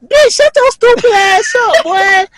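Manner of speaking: high, loud comic outburst; hammed-up furious squawk; outraged retort. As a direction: yell back at the giggler. Acted retort to giggling barber.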